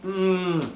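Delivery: savoring mmm over a bite; yummy hum. Real, not acted. Tasting the food.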